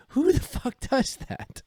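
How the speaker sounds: high, squeaky disbelief at a fake accent; incredulous. Real, not acted. Podcast host mocking a politician's fake French accent, incredulous squeal. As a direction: pitch it way up in outraged disbelief.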